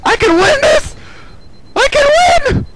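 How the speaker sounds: high, loud hopeful shriek; excited screaming. Real, not acted. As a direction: scream the belief you can win. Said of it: "I started screaming a little bit much".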